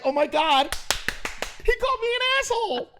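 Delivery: high-pitched scandalized audience member; mock outrage. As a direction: squeal it like an appalled crowd, hammed up. Acting out a shocked audience reaction.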